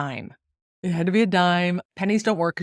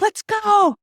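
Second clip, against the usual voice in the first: excited yell; 14 semitones higher, 5 dB louder than usual. Retold or imitated. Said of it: "I'm like".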